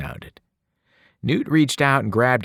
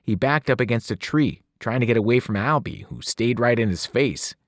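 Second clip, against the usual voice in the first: ordinary delivery, nothing marked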